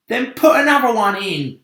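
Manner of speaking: high loud impatient bug voice; centipede voice. Acted. Centipede answering the glowworm, loud high impatient. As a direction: snap it back at her. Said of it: "said the centipede".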